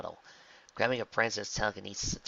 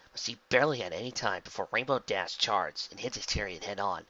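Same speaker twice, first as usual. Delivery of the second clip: plain reading voice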